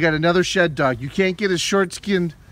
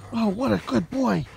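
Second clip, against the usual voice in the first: normal talking voice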